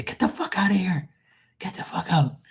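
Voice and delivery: hissing at an unseen peeping spirit; whispered. Retold or imitated. Friend hissing a whisper at the spirit in her shower.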